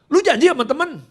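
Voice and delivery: high, loud mock challenge; snappish father. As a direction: snap it high like a pushy dad. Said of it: "Dia orangnya nyolot begitu".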